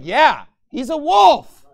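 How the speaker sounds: mock stern parent insisting; firm loud reply. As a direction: insist loudly to the child. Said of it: "It's like".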